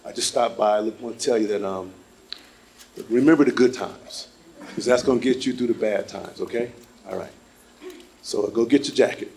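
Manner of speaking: low, soft voice of death; death's voice. Pretend. Death comforting the grieving son, low and soft. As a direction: soothe him like Death would. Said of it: "And it's death".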